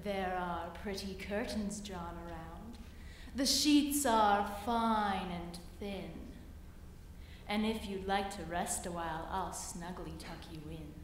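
low, soft coaxing spider voice; sly spider. Acted. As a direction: purr it low and sweet like a spider. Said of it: "said the spider to the fly".

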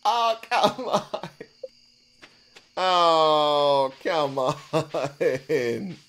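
real exasperated moan; whining protest. Real, not acted. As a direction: wail it in exasperation, stretching each word. Protests the loss in game.

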